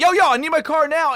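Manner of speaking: mock a pushy customer calling out; urgent demand. Customer hounding the lot guy for a car, urgent demand.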